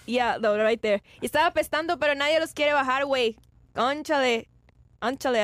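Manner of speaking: put-on Spanish reporter read with a light Mexican accent; playful Mexican TV reporter. Reading the chat's line as a Mexican reporter, in a Mexican accent. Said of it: "That's how they will say it".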